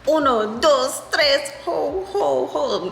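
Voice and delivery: high mock gibberish counting holes; imitating Spanish counting. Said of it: "they speak in Spanish or whatever".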